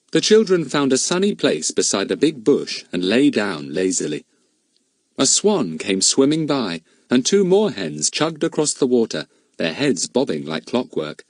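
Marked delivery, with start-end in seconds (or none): none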